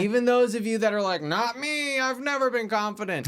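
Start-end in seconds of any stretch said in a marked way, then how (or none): 1.2-3.3 high-pitched doubter's objection; mock protest. Host mimicking listeners who never felt confident, high mock protest. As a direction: imitate a doubter, voice raised high.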